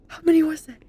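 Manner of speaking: ordinary talk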